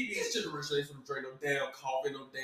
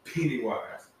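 ordinary delivery

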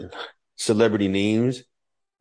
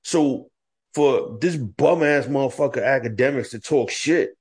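ordinary talk, nothing marked

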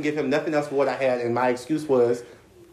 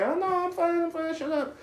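higher, softer embarrassed denial; high kid playing it cool; defensive denial; 6 dB quieter than usual. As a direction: brush it off, flustered and small. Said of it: "I'm like".

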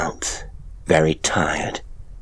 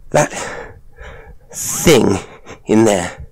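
breathless, louder and higher; panting; 4 semitones higher, 3 dB louder, slower than usual. Acted. Woman just fled the tank, panting. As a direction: gasp it out, out of breath. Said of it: "I panted".

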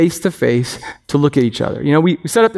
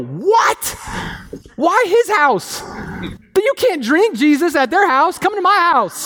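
much higher, whiny protest to Jesus; jealous grumbling; 13 semitones higher, slower than usual. Retold or imitated. Pastor playing himself griping at Jesus, high and whiny. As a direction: whine like a slighted child, slow at first. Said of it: "I'm the one grumbling over there".

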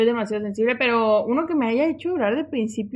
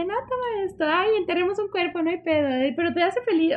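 naive alien creature; 6 semitones higher than usual. Retold or imitated. The little alien offers the girl anything, innocently. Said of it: "Él sí de".

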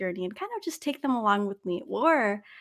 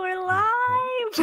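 excited; 13 semitones higher than usual. Real, not acted. Opening a live podcast.

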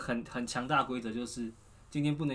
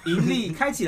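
loud, slow cheering chant; cheerful new year wishes; 6 semitones higher, 8 dB louder than usual. Acted. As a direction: cheer it out festively.

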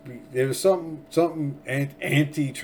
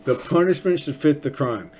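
emphatic declaration; 4 semitones higher, 6 dB louder than usual. Real, not acted. Preaching his rule emphatically.

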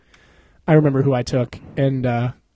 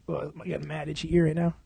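usual speaking voice

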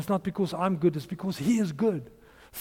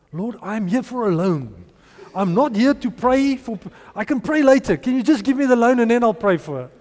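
higher and louder, fed-up complaint; impatient attitude; 4 semitones higher, 5 dB louder than usual. Retold or imitated. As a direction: brush God off, you're over it. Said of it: "that was my attitude".

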